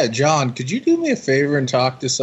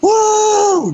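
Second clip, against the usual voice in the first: high, shouted beer-swigging "woo"; loud, drawn-out piercing holler; concert fan's whoop; 7 dB louder than usual. Retold or imitated. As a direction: cut loose like a fan hearing his hometown sung. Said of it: "taking a swig off his beer really fast".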